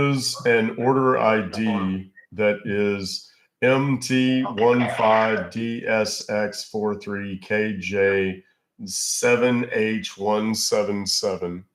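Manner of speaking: slowly spelled out; slow dictation. Acted. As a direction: read each character slowly. Reading out order ID.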